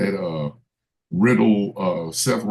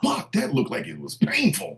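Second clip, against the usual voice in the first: commentator voice; 10 semitones higher, 3 dB quieter than usual. Retold or imitated. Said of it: "announce table is".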